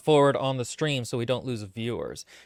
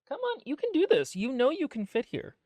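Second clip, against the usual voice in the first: much higher pet-talk voice; coaxing pet voice; 11 semitones higher than usual. Real, not acted. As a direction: sweet-talk the dog onto your lap. Coaxing the dog onto lap.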